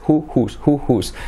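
ordinary delivery

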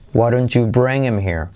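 usual speaking voice

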